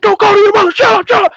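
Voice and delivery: high, loud shouted order; imitated sergeant shouting. Sergeants stopping a teen appealing to Mom, mock shouting. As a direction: cut the kid off with a yell.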